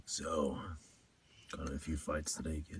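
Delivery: very low, soft detective voice; low mock interrogator murmur; deep voice. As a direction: play a hard-boiled cop, deep and quiet. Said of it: "one of them deep ones like".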